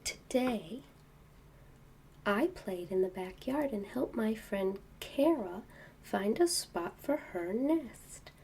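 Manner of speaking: soft quiet storybook reading; bedtime reading. Acted. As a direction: read softly to a child in bed. Reading book to child.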